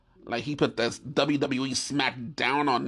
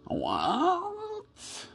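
high, drawn-out disbelieving "What?"; shocked what; 12 semitones higher, 4 dB quieter than usual. Acted. Hearing he is going back to New York, a stunned high what.

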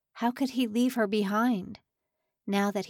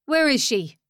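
nasal voice; 5 semitones higher, 7 dB louder than usual. Acted. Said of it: "The nasally voice was unmistakable".

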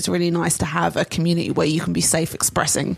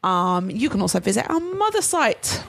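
ordinary talk, nothing marked

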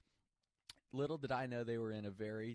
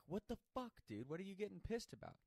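retold indignation; 8 semitones higher than usual. Acted quarrel with angry friend.

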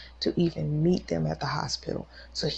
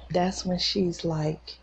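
ordinary delivery

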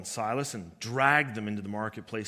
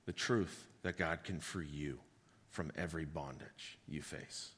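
much softer, low and intimate; quiet intensity; 9 semitones lower, 13 dB quieter than usual. Acted. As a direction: bring it down to a near hush. Soft intimate sermon close.

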